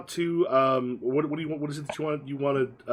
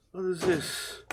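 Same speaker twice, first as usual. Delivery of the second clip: puzzled local; 4 semitones higher, much slower than usual. Acted. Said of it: "started looking at the money like".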